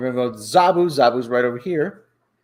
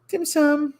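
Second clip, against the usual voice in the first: pet talk; 13 semitones higher than usual. Real, not acted. Host greets his cat on camera, high cooing. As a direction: coo up high at the kitty. Calling cat Dim Sum.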